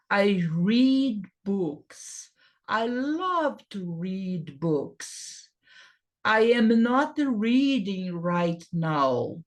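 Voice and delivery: "I read books" spoken very slowly, word by word; slow, clear dictation pace for learners; slow teacher model. Shown as a demonstration. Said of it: "O verbo ler no presente é read".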